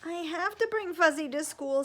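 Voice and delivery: high, loud insistent plea; pleading child. Acted. Owen insisting to his parents, loud and pleading. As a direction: beg desperately.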